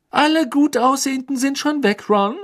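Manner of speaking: high-pitched, snippy retort. A narrator's reading. Hermine needles Ron about finding a partner, snippily. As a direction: toss it back at him pertly. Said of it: "sagte Hermine schnippisch".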